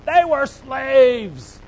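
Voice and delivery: drawn out and loud, each word weighted; slow emphatic declaration. Real, not acted. Preacher to the congregation, slow weighted emphasis. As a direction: let the words land heavy, slowly. Preacher hammers slaves point.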